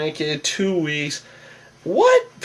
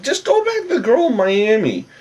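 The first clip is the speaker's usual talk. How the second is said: exasperated plea; 11 semitones higher than usual. Real, not acted. Exasperated aside to Vinny.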